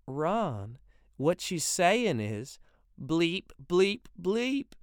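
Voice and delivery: a wife teasing the men by imitating Sputnik; mocking bleeps. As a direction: mimic the satellite with a grin.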